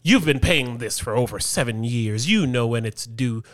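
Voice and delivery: a lightly voiced prim office lady scolding; mocking the HOA lady. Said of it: "this lady gonna respond and say".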